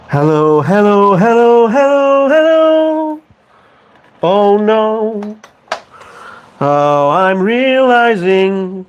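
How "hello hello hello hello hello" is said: rising hellos. Real, not acted. Calling out, rising each time.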